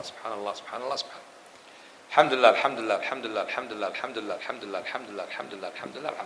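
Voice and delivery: low, rapid-fire repeated phrases; quick devotional recitation in a low running murmur; hurried dhikr. Shown as a demonstration. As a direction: rattle off the counts without pausing, mostly under your breath. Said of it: "استعجل على الأقل".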